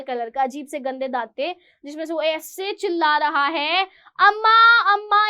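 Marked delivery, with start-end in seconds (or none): 4.2-5.3 higher and much louder, a wailing call of अम्मा; yelling out. Retold or imitated. Starving beggar wailing at the door, loud. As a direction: wail it out to be heard inside. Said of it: "इतनी तेज चिल्ला रहा है कि कान भी फट जाए".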